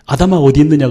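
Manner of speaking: a ringing call into the garden. Retold or imitated. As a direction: call out to Adam searchingly. God calling Adam.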